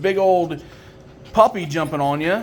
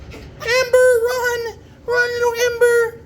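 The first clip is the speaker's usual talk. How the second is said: much higher, urging the little pup on; excited cheering; slower than usual. Real, not acted. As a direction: cheer the puppy to run. Urging puppy to run from Jen.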